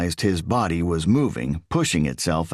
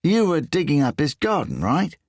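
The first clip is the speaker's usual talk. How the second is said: high, whiny English voice; whiny Englishman; 8 semitones higher than usual. A narrator's reading. English thief quizzes Riso in a high whiny voice. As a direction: push it up into a whine.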